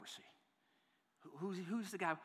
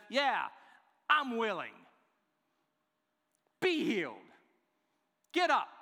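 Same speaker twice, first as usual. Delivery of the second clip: loud, high, emphatic declaration; impassioned preacher's shout in short bursts; passionate, forceful; 6 semitones higher, 6 dB louder than usual. Shown as a demonstration. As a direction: command it with fierce conviction, each phrase punched out. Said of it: "this is how he says it".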